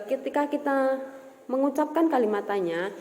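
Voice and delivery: ordinary delivery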